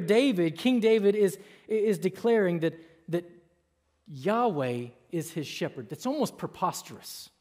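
usual speaking voice

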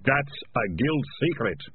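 mildly snappish, in a put-on voice; understated irritated rebuke; snapped. A narrator's reading. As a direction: cut him off crossly. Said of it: "Ratcatcher One snapped at him".

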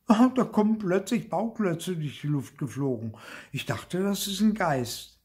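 high-pitched, agitated little sister; startled explaining. A narrator's reading. Sister explains she took flying blocks for a ghost, startled.

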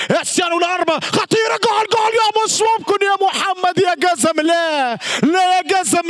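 fast, high shouted goal; Arabic goal scream; goal call. Real, not acted. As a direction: explode with joy.

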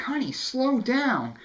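high, slow mom voice; drawn-out high motherly plea; mimicking mom. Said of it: "my mom's like".

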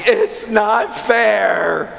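put-on high, very slow wailing child; mock crying. Daughter wails to her father about the toy, mock crying. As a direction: stretch it into a mock child's wail. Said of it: "Eva breaks down crying".

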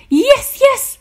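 very high, loud excited shout. A narrator's reading. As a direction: shout it out in triumph. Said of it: "shouted Grump".

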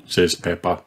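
ordinary delivery

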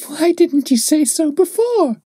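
put-on high child's voice, bright and gleeful; gleeful little girl. A narrator's reading. Little girl is relieved they will die together, high gleeful voice. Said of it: "laughing gleefully".

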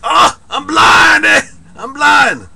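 mock wailing. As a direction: ham up a blind man's wail. Mock wail about blindness.

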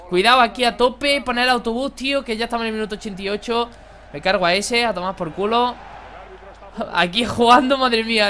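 ordinary delivery

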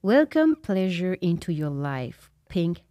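slow, careful English accent reading; deliberate Quebecer's English accent; showy English accent. Shown as a demonstration. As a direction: present it slowly in your best English accent. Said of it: "vous allez voir mon bel accent anglais".

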